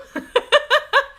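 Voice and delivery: a squealing burst of laughter; high giggle. Real, not acted. Laughing at her own quantum leap pitch. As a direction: giggle with delight.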